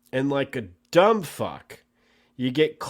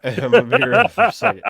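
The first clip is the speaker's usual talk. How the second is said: higher and louder, fast and laughing through it; repeating the line while laughing at the co-host's teasing; 7 semitones higher, 9 dB louder, faster than usual. Real, not acted. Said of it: "You haven't been real this whole time?".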